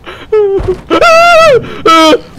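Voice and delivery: high, loud wailing scream; real jump-scare shriek; frightened shriek. Real, not acted. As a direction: scream as if about to cry.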